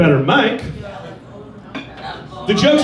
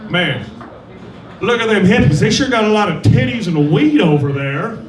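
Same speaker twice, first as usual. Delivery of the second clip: leer over at the hippies. Retold or imitated. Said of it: "they were like".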